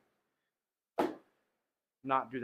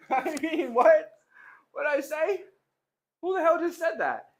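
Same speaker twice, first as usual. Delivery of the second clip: high, playful mock outrage; mock shock; 12 semitones higher, 4 dB louder than usual. Streamer to viewers, pretending someone else said it, high mock shock. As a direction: feign shock at your own words. Mock denial after laugh.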